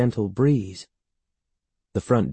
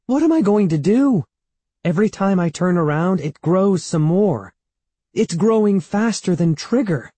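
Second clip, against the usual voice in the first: high-pitched, louder panic; distressed cry; 9 semitones higher, 5 dB louder than usual. A narrator's reading. Evan despairing over the growing monster blood, distressed cry. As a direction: cry it out in rising desperation. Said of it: "Evan cried".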